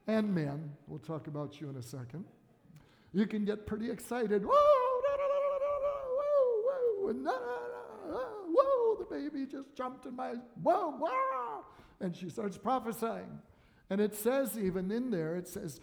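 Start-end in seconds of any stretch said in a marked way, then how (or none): 4.4-11.6 very high, loud, sung whoas and da das; hammed-up high singsong whoa and da da; mock joyful squeals. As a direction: play an overjoyed woman squealing. Acting Elizabeth's joyful outburst.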